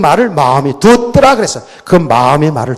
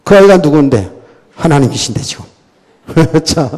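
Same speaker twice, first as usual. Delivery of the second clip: ordinary talk, nothing marked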